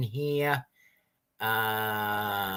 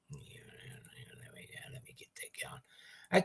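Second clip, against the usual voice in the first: whispered aside; 6 semitones higher, 16 dB quieter than usual. Real, not acted. Muttered aside while starting stream.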